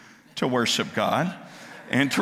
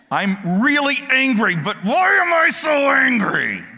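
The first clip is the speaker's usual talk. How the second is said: higher and louder, breaking into a half-laugh, then drawn out; frustrated outburst; 10 semitones higher, 7 dB louder than usual. Pretend. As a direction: blurt it out like someone fed up with their own temper. Cain's inner voice, outburst.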